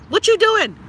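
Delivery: high, sharp outburst; exasperated demand. Real, not acted. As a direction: throw the question at them.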